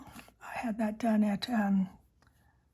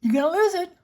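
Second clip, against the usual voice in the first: higher, louder warning to herself; mock self-warning; 8 semitones higher, 7 dB louder, faster than usual. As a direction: scold yourself in a raised voice. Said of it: "and I kept saying to myself".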